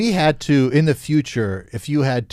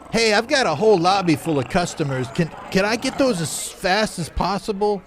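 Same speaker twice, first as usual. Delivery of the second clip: high manager voice; 8 semitones higher than usual. Acted. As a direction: voice a fussy diner manager. Manager character, higher voice.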